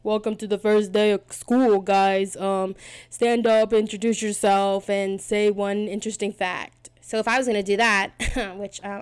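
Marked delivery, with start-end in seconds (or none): 0.0-6.9 loud, slow first-day teacher voice; mock teacher. Teacher greeting students on the first school day, loud and slow. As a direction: announce it to the class like a teacher. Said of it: "the teacher's like".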